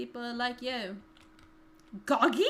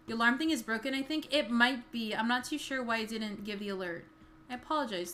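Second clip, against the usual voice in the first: usual speaking voice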